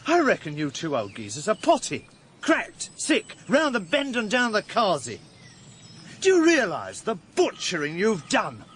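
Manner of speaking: high-pitched, incredulous outrage; heated denunciation, voice climbing high; outraged accusation. Acted. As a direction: tell them both they are mad. Denouncing the two older men.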